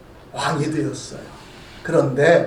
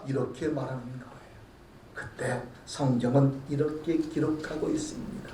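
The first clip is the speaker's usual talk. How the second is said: normal talking voice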